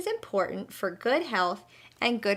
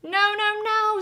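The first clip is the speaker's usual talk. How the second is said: much higher, urgent cry; mock scream; 11 semitones higher, 4 dB louder than usual. Butterfly warning Babaroo, high alarmed cry. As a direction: stop him in alarm, high. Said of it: "screams the butterfly".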